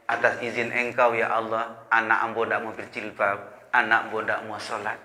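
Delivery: soft, humble plea to God; hushed prayer. Retold or imitated. Mother quietly praying for her daughter, soft and humble. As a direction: pray it quietly, head bowed. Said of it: "dia nyambung kepada Allah".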